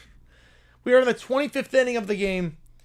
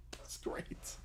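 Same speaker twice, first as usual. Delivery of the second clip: whispered aside; 22 dB quieter than usual. Acted.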